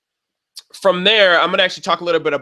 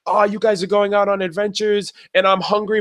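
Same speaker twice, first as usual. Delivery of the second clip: high-pitched, whiny-style character imitation; high mock complaint; imitating Nora; 6 semitones higher than usual. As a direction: put on a high voice, drawing out the complaint. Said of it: "when she was like".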